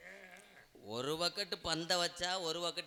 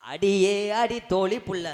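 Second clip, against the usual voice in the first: long, slow, very loud hail; calling out; 3 semitones higher, 13 dB louder, slower than usual. Acted. Performer summons her friend on stage, drawn-out loud call. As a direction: holler for your friend, stretching it out. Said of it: "டோலியை கூப்பிடு".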